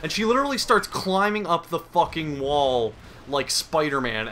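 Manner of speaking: ordinary talk, nothing marked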